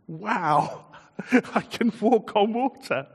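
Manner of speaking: laughing, giddy disbelief; elated Peter. Acted. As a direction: voice him thrilled he's walking on water.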